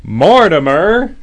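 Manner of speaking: slow build to a high, loud shout; yelling. Acted. Father at Mortimer's bedroom door, drawn-out yell. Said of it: "He opened the door and yelled".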